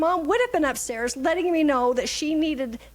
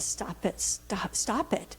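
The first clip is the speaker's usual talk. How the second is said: softer, breathy whisper to herself; whispered self-check; 3 semitones lower, 6 dB quieter, slower than usual. Retold or imitated. Grandmother quietly stopping her own reaction, whispered. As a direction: hush yourself under your breath. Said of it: "And I went".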